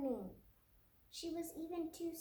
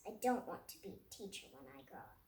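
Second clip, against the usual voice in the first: put-on much lower and fast, a sulky child's mutter; low grumble; 12 semitones lower, 3 dB quieter, much faster than usual. A narrator's reading. Lily's angry note to her teacher, low and quick. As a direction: rattle it off low under your breath.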